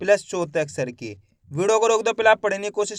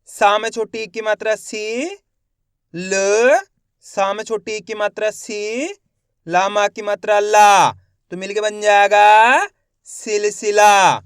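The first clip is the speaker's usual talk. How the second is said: slow reading demo; 6 dB louder, slower than usual. Shown as a demonstration. Teacher shows pupils how to blend सिलसिला, slowly and loudly. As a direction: build the word piece by piece, drawing the last word out slowly.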